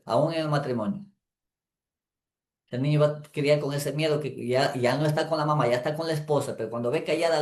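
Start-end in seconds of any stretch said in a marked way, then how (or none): none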